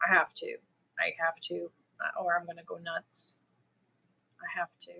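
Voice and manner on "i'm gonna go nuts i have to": breathy whisper to herself. Real, not acted. To herself about going home, whispered.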